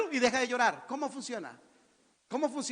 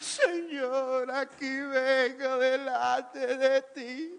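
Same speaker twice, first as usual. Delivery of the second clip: slow, higher mock sobbing prayer; mock crying prayer; 3 semitones higher, much slower than usual. Preacher mocking a mother praying over her children, mock sobbing. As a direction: wail a self-pitying prayer.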